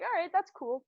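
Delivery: high, bright "all right, that's cool"; casual acceptance. Retold or imitated. Friends hearing about seizures, casually accepting. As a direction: say it like it's nothing at all.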